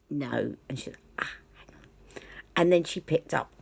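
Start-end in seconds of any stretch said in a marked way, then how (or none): 1.2-2.2 a muttered, hushed aside; whispered to herself. Retold or imitated. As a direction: breathe it out under the breath, distracted. Assistant pauses mid-search.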